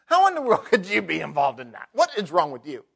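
loud scolding disbelief; mock browbeating of a sinner. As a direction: berate them from above. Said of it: "browbeat them or look down our nose".

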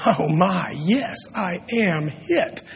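soft, slow inward aside; saying to oneself. Acted. Preacher voices listeners saying to themselves, hushed and rueful. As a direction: murmur it as a private admission. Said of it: "some of you are saying to yourself".

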